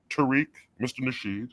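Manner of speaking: normal talking voice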